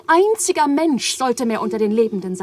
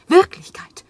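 exalted cry; 7 semitones higher, 9 dB louder than usual. Acted. Eva's feverish outburst of revelation.

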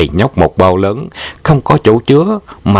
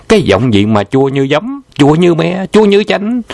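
rising mockery; 8 semitones higher than usual. A narrator's reading. Narrator mocks the sour voice.

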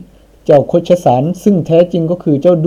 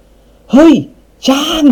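startled shout; 12 semitones higher, much slower than usual. A narrator's reading. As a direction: cry out in shock at a sudden noise. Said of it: "อุทานลั่นออกมาอย่างตกใจ". (ตะโกนอุทานอย่างตกใจ)

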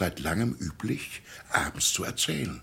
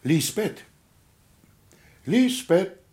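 higher and louder, calling into the house; 9 semitones higher, 5 dB louder, slower than usual. Acted. Pastor calling for the housekeeper, calling out. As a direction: call her name across the rooms, rising in force.

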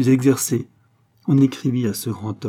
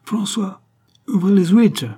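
much higher, louder call across the house; shouting; 9 semitones higher, 5 dB louder than usual. A narrator's reading. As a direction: yell the order up the stairs. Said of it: "en criant".

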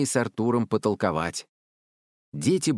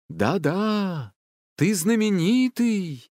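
high, drawn-out proclamation; grand forest voice; 10 semitones higher, much slower than usual. A narrator's reading. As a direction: intone it slowly and grandly. Forest spirit flatters the commander. (величавый протяжный голос)

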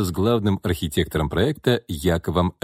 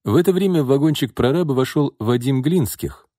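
usual speaking voice

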